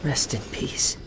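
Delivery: soft breathy whisper, barely voiced; whispered farewell. Whispering a last farewell to the fading blade spirit. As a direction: let the words go out on the breath, as a last goodbye.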